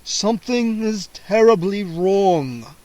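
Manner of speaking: slow vampire voice stressing terribly; worried vampire. A narrator's reading. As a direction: lean on terribly in dread. Vampire realizes trouble.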